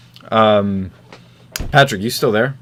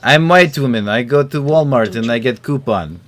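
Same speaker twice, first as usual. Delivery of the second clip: mock Russian-like accent; 3 semitones higher, 3 dB louder than usual. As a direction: echo the joke in a fake Russian accent. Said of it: "why we did the accent".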